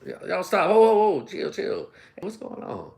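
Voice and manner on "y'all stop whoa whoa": ordinary talk, nothing marked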